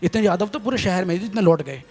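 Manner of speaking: normal talking voice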